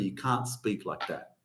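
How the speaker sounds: low, soft dad telling off; a quiet hint of a scolding father; dad scolding his swearing son; stern dad. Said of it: "my dad's telling me".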